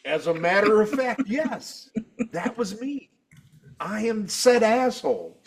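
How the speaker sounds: high, measured self-important reply; mock-proud admission. Teller owning up to being that guy, mock proud. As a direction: own up with pompous mock dignity.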